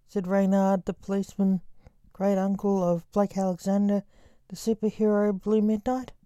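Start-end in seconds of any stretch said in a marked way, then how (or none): none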